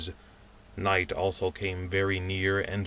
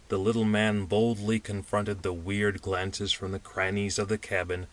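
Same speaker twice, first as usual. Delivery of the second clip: usual speaking voice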